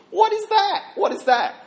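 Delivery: high, loud incredulous outcry; mock exasperation. Dad to daughter over her radio choice, mock exasperated. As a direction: demand it in exaggerated, high-pitched disbelief.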